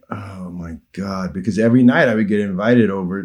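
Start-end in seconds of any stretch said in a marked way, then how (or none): 0.1-1.3 slow, low, hushed groan of thought; quiet dismay. Retold or imitated. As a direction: sigh it softly and slowly to yourself. Inner dread at another dull evening.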